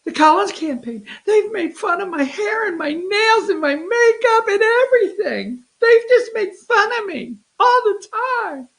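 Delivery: high, whiny mock sobbing complaint; mock crying Kelly Loeffler. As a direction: ham up a tearful candidate whining. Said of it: "I wanna do my impression".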